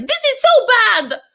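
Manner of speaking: very high, exaggerated mock disapproval; hammed-up shrieky judging of someone; judgmental. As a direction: squeal the verdict in imitation. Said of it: "like, judgmental".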